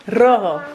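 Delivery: loud "Rojo" with trilled first R; rolled R. Shown as a demonstration. Mother models it after child succeeds, "Rojo" rolled and loud. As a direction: punch out "Rojo", rolling the R. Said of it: "Can you roll your R?".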